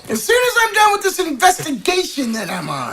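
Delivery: high character voice; reply high-voiced to a little kid; [name] answering child.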